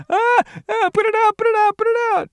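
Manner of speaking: very high, loud frantic cries; acted panic. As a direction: scream for help as if on fire, hammed up. Character's hair is on fire.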